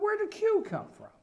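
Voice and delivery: high-pitched mock bafflement; high, incredulous retold question; baffled question. Retells his own baffled reaction.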